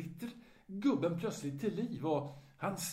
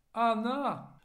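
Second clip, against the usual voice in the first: put-on old man calling, higher and louder; 9 semitones higher, 6 dB louder than usual. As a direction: call toward the kitchen. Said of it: "ropar nu den äldre herrn".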